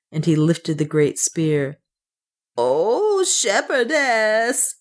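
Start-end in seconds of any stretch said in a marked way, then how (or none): 2.6-4.7 high, drawn-out wail. A narrator's reading. Servant pleading with her mistress, wailing. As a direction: stretch the words into a plaintive cry. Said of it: "she wailed".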